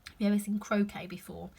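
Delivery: plain reading voice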